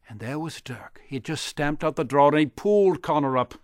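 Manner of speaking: ordinary delivery, nothing marked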